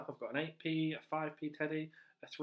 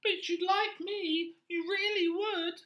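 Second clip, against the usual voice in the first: much higher, louder squeaky rat; rat character; 8 dB louder, slower than usual. Acted. Teacher voicing the pet-shop rat to children, high and loud. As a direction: play the pushy little rat, high and loud.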